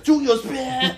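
mimicking a sudden high-pitched yell; imitated shout. Comedian imitating the rapper's sudden shouting, mock shout. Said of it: "I heard him just start shouting".

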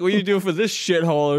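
mock-berate someone for where they work; angry old teacher. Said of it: "That angry old fuck of an Italian".

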